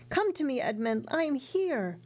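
high glad call, slowing on the name; joyful cry. Acted. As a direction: call out to him with joy. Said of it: "opened it, crying".

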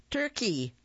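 high, sugary calling out; sweetest voice. A narrator's reading. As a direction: coax him out like luring a pet. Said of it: "called in his sweetest voice".

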